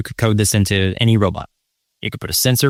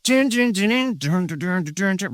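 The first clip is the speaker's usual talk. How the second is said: high sung dun dun riff; vocal imitation of a guitar riff, high; instrument riff; 8 semitones higher, 3 dB quieter than usual. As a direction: hum an instrument riff with your voice. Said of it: "we're like".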